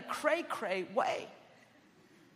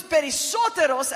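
perisoteros punched out much louder; stress on perisoteros; 4 semitones higher, 10 dB louder than usual. Retold or imitated. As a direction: hit perisoteros hard, as a warning. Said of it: "there would be an emphasis on that word".

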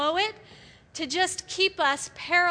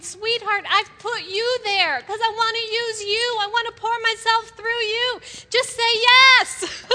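tender, pleading invitation; 6 semitones higher, 6 dB louder than usual. Retold or imitated. God calling a hesitant woman, tender and pleading. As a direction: coax gently, then swell on yes. God's loving call voiced.

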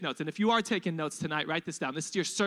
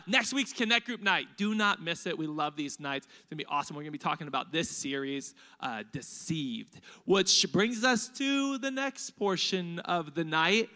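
ordinary talk

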